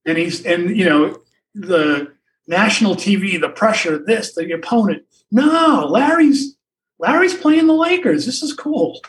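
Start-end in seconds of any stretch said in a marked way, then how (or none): 5.3-9.0 excited. Retold or imitated. Excited kid-like Larry.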